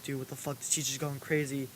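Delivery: very soft, imitated classroom aside; retold hushed murmur. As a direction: act out classmates quietly reacting among themselves. Classmates retold murmuring among themselves.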